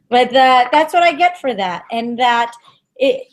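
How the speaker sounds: usual speaking voice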